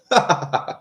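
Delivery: high burst of laughter. Real, not acted. Streamer amused by own crazy theory, laughing. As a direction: crack up at your own nonsense.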